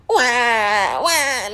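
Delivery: drawn-out mock wail; pretend crying. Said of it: "He started crying".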